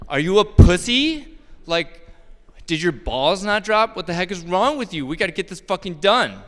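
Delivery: hammed-up jeering tough guy; mock macho yelling. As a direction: rib a struggling coworker, loud and rough. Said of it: "It's like".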